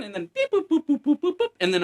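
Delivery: high sing-song beep boop; mock computer beeps. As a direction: chirp it like a scanning machine. Imitating facial recognition computer.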